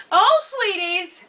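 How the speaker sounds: drawn-out, high sing-out call. Retold or imitated. Arriving host calling for her sweeties in the crowd, calling out. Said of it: "should I just start calling sweeties".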